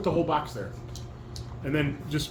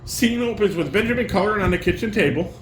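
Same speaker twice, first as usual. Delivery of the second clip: reading stage directions; 5 semitones higher, 4 dB louder than usual. Acted. Reads scene opening.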